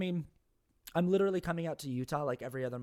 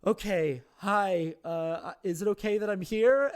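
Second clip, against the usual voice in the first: awkward polite greeting; 6 semitones higher, 7 dB louder than usual. Retold or imitated. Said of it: "And I was like".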